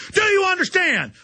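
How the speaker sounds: mock federal agent shouting the threat; menacing shout. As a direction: bark the question at them like a bully agent. Agent threatening gun show owner.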